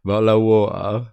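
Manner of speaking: mock British accent, T's dropped. Mock British accent for the chat. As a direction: drop the T's like a Brit.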